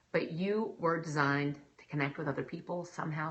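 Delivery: ordinary delivery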